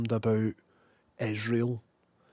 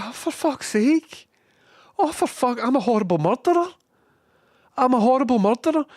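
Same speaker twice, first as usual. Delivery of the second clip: high, louder, fast hammed-up wail of guilt; mock despair; 13 semitones higher, 6 dB louder than usual. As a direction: shriek it like a melodramatic self-accuser. Mock self-reproach over dead birds.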